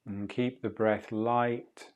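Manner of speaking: calm, unhurried meditation guide voice; soothing breathwork guide talking a student through the breath; guided breathing meditation. Acted. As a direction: ease the listener through each breath, gently.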